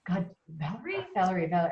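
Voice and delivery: quietly rack your brain for the name; a mock muttered struggle to place the name; muttering to herself. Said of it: "you know how you go".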